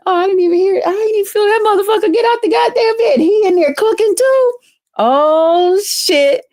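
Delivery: high, fast delighted disbelief; mock surprise. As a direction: squeal it like you just caught him cooking. Waking to find him cooking.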